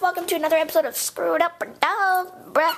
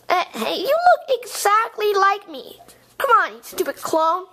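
normal talking voice

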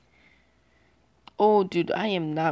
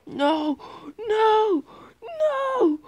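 panicked crying shout; 8 dB louder, slower than usual. Real, not acted. Gamer about to die.

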